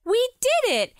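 high, loud triumphant cheer; excited cheer. Acted. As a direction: shout with joy. Cheering after the show.

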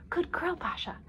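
high, breath-filled praise for a pet; hushed, high-pitched encouragement; whispered. A narrator's reading. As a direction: praise the dog in a light whisper. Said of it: "Shirley whispered to her".

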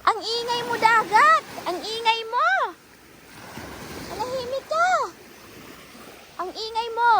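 hammed-up scolding of the sea; mock scolding. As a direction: tell off the noisy sea like a child. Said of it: "Parang baliw lang".

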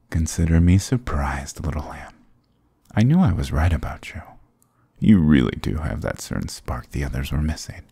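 ordinary talk

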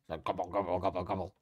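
low turkey gobbling noise; turkey gobble. Shown as a demonstration. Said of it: "Like a turkey".

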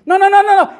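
high, laughing refusal; amused no no no. Real, not acted. Laughing rejection of weak version.

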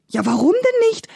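very high, pleading protest; exasperated, high-pitched desperation; desperate cry. A narrator's reading. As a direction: cry out in despair, voice shooting up. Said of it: "rief der Bauer ganz verzweifelt".

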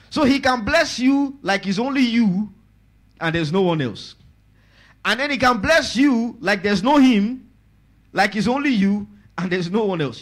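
high, loud, driving sermon voice; preaching at full force. Acted. Pastor assuring the congregation of blessing, full-force preaching. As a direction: hammer home the blessing.

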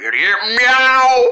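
slow, stretched silly meow; funny voice. Acted. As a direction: drag out the meow for laughs. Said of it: "I'm gonna do it again".

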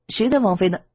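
high, startled ask; stunned question. A narrator's reading. Lan Feng stunned by Wang Tong's news, high startled question. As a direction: blurt it out, caught off guard. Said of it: "蓝峰愣愣地说".

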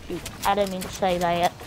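ordinary talk, nothing marked

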